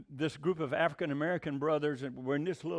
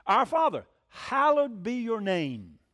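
loud, slow, solemn recitation; prayer recitation; 3 semitones higher, 6 dB louder, slower than usual. Shown as a demonstration. Preacher reciting the Lord's Prayer to the congregation, solemn intoning.

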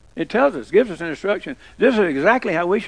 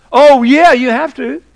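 mock loud insistence; 5 semitones higher, 10 dB louder than usual. Mocking phone buyers' excuse.

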